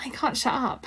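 ordinary talk, nothing marked

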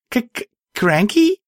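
high, stuttering question; tentative high-pitched stutter; hesitant stammer. A narrator's reading. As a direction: stumble on the first sound, then lift into a question. Heroine struggles to recall a name.

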